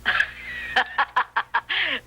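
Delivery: put-on old-woman cackle, tickled; amused cackle. [name] laughs at the question about her hat, cackle. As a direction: let out a hearty cackle at the joke.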